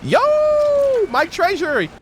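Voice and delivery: ordinary talk, nothing marked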